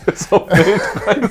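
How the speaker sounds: repeating the joke while laughing. Pretend. Friend repeats the joke, laughing.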